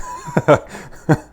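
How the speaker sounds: high laugh at herself; burst of laughter. Real, not acted. Cook laughing at her own sugar slip. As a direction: crack up at the mix-up.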